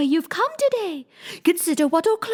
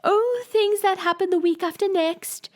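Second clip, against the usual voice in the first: careless tone; 11 semitones higher than usual. A narrator's reading. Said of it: "in a careless tone".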